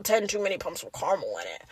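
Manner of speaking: muttered under the breath in disgust; low, quiet disgusted aside. Retold or imitated. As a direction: grumble it quietly, put off by the sweetness. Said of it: "I'm like".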